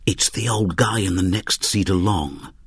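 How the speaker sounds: ordinary delivery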